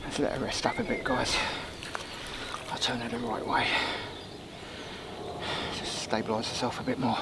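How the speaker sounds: near-whispered, breathy hush beside the fish. Real, not acted. Angler hushing over his resting carp, near-whispered. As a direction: keep it hushed so the fish can rest.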